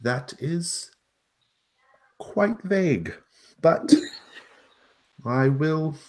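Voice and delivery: high, loud, quick gnome, friendly; a chirpy gnome talking to a cat. Acted. Gnome replies.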